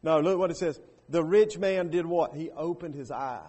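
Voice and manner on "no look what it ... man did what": ordinary talk, nothing marked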